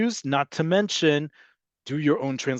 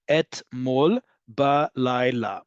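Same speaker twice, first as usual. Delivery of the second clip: "Etmol balayla" slowly, one syllable at a time; drawn out by syllable; much slower than usual. Shown as a demonstration. Teacher showing how long the phrase is, slow syllable-by-syllable "Etmol balayla". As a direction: spell out every beat of "Etmol balayla". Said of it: "the same thing in longer Hebrew".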